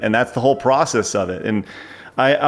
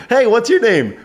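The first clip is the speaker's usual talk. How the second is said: put-on kids' host voice; 10 semitones higher than usual. Uncle Nutsy asking a kid.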